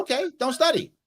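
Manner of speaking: high, airy dad shrugging it off; breezy indifference. Retold or imitated. Dad to daughter skipping homework, breezy. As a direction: say it like it doesn't matter at all. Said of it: "I said".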